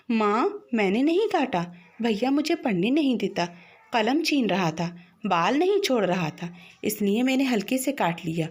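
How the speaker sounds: usual speaking voice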